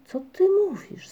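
high, eager cry of surprise; calling out. A narrator's reading. Migotek calls out to the wanderer, high and eager. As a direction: call it out, sharply curious. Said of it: "zawołał Migotek".

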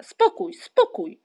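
high, very loud repeated command; calling for quiet. A narrator's reading. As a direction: shout the children down. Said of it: "zawołał tatuś Muminka".

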